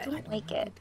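soft, hushed insistence. Real, not acted. Repeating her complaint much softer.

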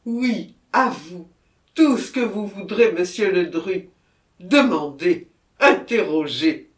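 loud, fervent plea to be questioned; agitated consent. A narrator's reading. Murderer agreeing to answer Monsieur Ledru, agitated consent. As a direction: offer yourself up at full voice.

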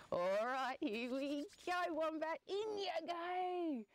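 drawn-out, rising triumphant send-off; gloating dingo. Acted. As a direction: stretch it out as you heave the victim in. Dingo heaves wombat toward pot.

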